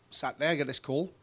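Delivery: ordinary talk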